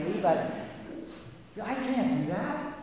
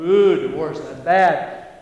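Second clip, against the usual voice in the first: mock pious; 10 dB louder than usual. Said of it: "they go".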